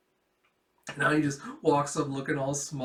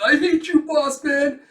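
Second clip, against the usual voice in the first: high, loud hammed-up fury; mock outburst; 13 semitones higher, 11 dB louder than usual. Flunky blaming his boss, hammed-up angry outburst. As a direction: wail it loudly at him.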